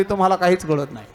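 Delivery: ordinary talk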